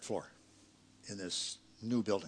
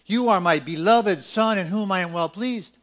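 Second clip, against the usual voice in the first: proclaimed; 8 semitones higher, 11 dB louder than usual. Acted. Quoting God's words.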